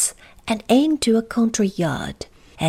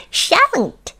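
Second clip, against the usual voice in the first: high giggle, cheeky refusal; high-pitched impish creature; grinning goblin. Acted. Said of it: "grinned the Goblin".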